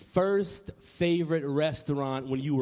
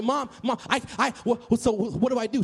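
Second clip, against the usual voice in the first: put-on high, stammering kid; overexcited child; 5 semitones higher, faster than usual. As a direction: trip over the words in acted eagerness.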